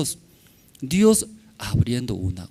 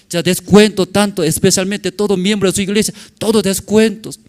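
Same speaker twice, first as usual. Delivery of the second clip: high, loud sales pitch, pushing hard; mock salesman, much higher and louder; eager salesman; 7 semitones higher, 6 dB louder than usual. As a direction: sell it like a pushy vendor offering discounts. Acting out the pushy salesman.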